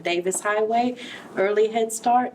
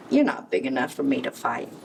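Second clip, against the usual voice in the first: hasty excuse; 6 semitones lower, faster than usual. A narrator's reading. Ladybug backs down fast.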